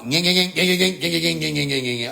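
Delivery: rapid repeated whine of a stuck car; imitated car noise. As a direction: imitate a car straining on a rock. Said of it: "The car jam up on a rock".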